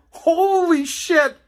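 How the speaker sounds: high and slow; horrified gasp of an exclamation; aghast. Pretend. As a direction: drag it out in horror. Imagining doll in bedroom.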